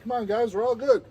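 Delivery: high and loud, we're all friends here; pleading reassurance. Retold or imitated. Talking his way in.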